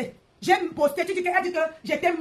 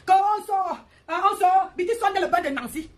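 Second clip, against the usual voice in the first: mocking a friend who yelled in the car, raised voice; mock shouting; 4 semitones higher than usual. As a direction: imitate her shouting in the car, half-mocking. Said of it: "tu criais dans la voiture".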